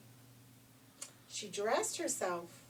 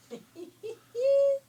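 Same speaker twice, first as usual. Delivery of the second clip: high, loud evil cackle; shrill triumphant villain laugh; wicked queen's cackle; 14 dB louder than usual. Acted. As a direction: cackle like a gloating witch queen. Queen gloating at her magic mirror.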